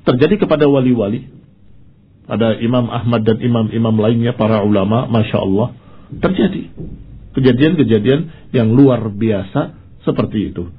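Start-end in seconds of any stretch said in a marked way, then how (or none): none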